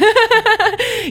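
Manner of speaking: high burst of real laughter; laughing. Real, not acted. Guest laughs after fumbling the web address, real laughter. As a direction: crack up at the slip.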